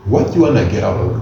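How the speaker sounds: ordinary talk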